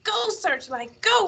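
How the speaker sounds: high-pitched urging cry; narrator's eager young racer. A narrator's reading. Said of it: "little Willie cried out".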